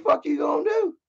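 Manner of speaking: high-pitched goading dare; challenging. Retold or imitated. Heckler retelling his dare to the boyfriend, challenging.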